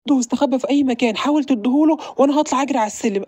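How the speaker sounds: ordinary delivery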